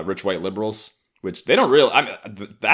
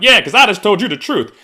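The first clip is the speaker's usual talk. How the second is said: loud, high smug retort; defiant yell; 14 semitones higher, 12 dB louder than usual. Retold or imitated. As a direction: shout it smugly. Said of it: "I'm like".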